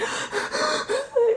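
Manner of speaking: high, gasping breaths; mock panicked gasps from crying; imitated hyperventilating. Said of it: "I'm, like, hyperventilating".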